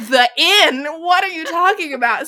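mock shock. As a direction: blurt it out, caught off guard. Outrider learns of the posting inn.

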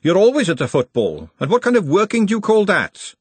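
high, loud, cross cook; snapped scolding. A narrator's reading. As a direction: tell him off sharply. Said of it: "snapped Glenda".

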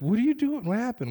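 high, loud startled voice; jolted awake and demanding answers; startled question. Retold or imitated. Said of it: "he's like".

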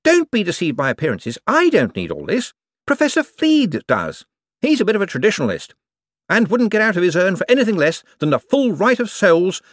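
high, eager, bright-voiced wizard; a jumpy official covering for himself. A narrator's reading. Hicks excusing the props.

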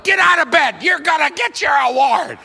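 put-on high, loud order to a kid; mock parental shout. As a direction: bellow like a pushy parent rousing a child. Voices parent forcing sick kid up.

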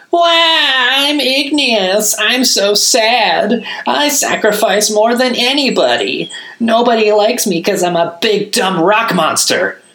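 very high, loud, slow fake crying whine; mock wailing. A narrator's reading. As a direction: ham up a sobbing mockery of the golem. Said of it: "he wailed".

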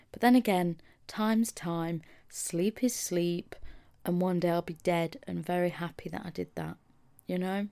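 normal talking voice

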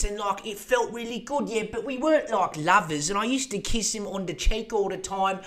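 high-pitched mock Cockney lady; hammed-up Cockney, protesting her case; British Cockney accent. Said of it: "she has that British Cockney accent".

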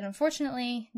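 normal talking voice

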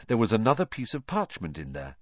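ordinary delivery

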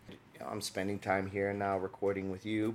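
ordinary delivery

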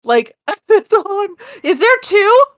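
high panicked shrieks; screaming at a spider. Real, not acted. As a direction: freak out, loud and high. Freaking out at creepy video.

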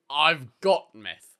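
slow, loud boast; proud yell. Retold or imitated. Quoting the man in the urinal, proud drawn-out yell. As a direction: belt it out proudly, stretching the words. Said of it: "he yells, really proudly".

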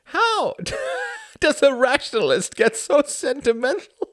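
breathless high whispered laughter; doubled over with laughter; laughing hard. Acted. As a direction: squeeze the words out through giggles. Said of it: "He laughed again, harder".

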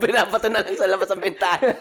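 high, loud, laughing banter; giggling high joke. Real, not acted. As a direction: say it while cracking up.

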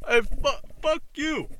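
high mocking taunt; high jeering voice imitating Steve. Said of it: "he's over here".

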